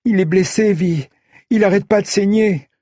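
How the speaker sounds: put-on high, tearful panicked boy; crying boy. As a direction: plead through tears. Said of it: "Noah ... en pleurant".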